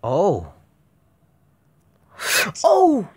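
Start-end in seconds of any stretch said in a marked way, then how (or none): 2.6-3.1 high, loud yelp of fright; real startled scream. Real, not acted. Gamer jump-scared, real scream. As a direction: shriek at the jump.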